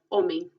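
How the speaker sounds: "homem" with no h sound; silent H. Shown as a demonstration. Teacher shows the silent Portuguese H. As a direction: say "homem" starting on the o. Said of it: "No tiene sonido".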